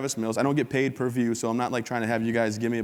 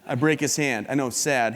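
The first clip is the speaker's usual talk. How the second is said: ordinary talk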